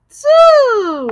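Two said high and loud, just like the number. Shown as a demonstration. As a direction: model Two clearly for the class. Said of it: "Say that again".

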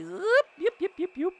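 squeaky high yips; little falsetto yelps; high yipping. Acted. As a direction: yip like an excited pup. Celebrating a play.

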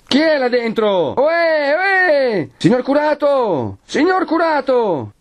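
high, drawn-out hailing cries; crowd calling out. A narrator's reading. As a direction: call up to a closed window, slowly. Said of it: "si sentì chiamare a voce di popolo". (richiamo di popolo, acuto e prolungato)

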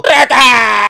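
alarido agudo a todo pulmón; grito de alarma. Retold or imitated. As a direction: grita la alerta, alargándola. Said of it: "cuando escucho el grito". (grito desgarrado imitando al tío espantado)

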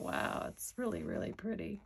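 whispered, breathy awe; hushed wow. Retold or imitated. Retelling her own admiring thought to viewers, whispered. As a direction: hush it like a private thought. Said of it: "But I thought".

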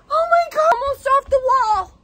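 real high, loud shriek of terror; shrieking, breaking toward tears; panicked scream. Real, not acted. Spider comes off the wall.

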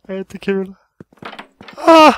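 usual speaking voice

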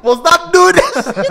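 high, loud protest through laughter; hammed-up exasperated retort; laughing mock retort. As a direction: snap back loudly while cracking up. Acted retort to parents, laughing.

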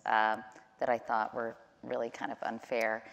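usual speaking voice

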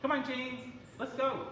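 high-pitched encouraging call; coaxing a pet. Real, not acted. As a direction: coax the bird over, lilting up. Coaxing the bird to come.

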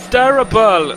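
loud angry yell; frustrated shout. Real, not acted. Shouting at a bad game moment.

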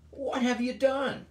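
high, sharp demand from a governor; authoritative demand. Acted. Pilate demanding of Jesus with authority. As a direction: bark it out with authority. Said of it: "a question that is displaying his authority".

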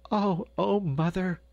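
put-on high, girlish, teasing protest; laughing young Gretel. A narrator's reading. Gretel teasing her mother, high and merry. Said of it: "laughed Gretel".

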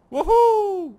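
very high, loud joyful whoop; very high, loud woohoo while leaping; excited whoop. Real, not acted. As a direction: let out a wild cheer. Jumping off a cliff.

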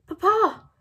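loud, high cry of joy at finding him; excited cry. A narrator's reading. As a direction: blurt out his name in relief. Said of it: "trying not to raise our voices".

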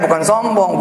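ordinary talk

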